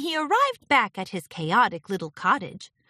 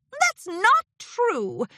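high, stretched-out indignant denial; shot back; 4 dB louder, much slower than usual. A narrator's reading. Discord accused of funny business, shooting back indignantly. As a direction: protest it slowly, pitched way up. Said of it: "Discord shot back".

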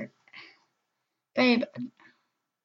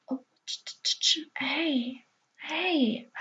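startled whisper; 5 semitones higher, 7 dB quieter, much slower than usual. Acted. Partner wakes, startled.

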